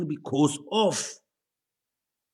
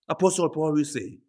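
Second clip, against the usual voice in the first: ordinary talk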